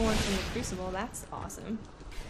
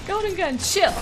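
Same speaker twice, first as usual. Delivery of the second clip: high, pleading whine; high plea; 10 semitones higher than usual. Real, not acted. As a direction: beg them to stop in a squeaky voice. Pleading with enemy super.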